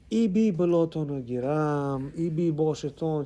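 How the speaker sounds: slow, drawn-out lamenting praises; Bukharian praises. Retold or imitated. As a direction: imitate an old lady pouring out praises. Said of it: "She starts with the Bukhari and praises".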